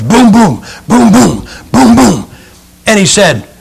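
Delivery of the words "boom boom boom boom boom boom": punched, high booms in pairs; imitated hammer blows.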